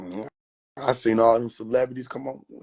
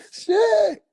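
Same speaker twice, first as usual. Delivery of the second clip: much higher, louder put-on voice; Shroom cursing; 5 dB louder than usual. Beaten Shroom swearing in a high voice. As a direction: spit out the curse as the mushroom. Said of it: "They abusing Shroom".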